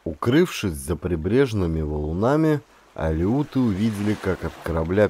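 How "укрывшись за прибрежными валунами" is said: ordinary delivery, nothing marked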